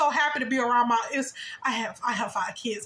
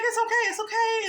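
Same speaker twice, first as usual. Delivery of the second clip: put-on high, soothing voice for a child; retold reassurance; 11 semitones higher than usual. Host to a child who spilt her drink, soothing. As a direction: reassure a little one, pitched well up.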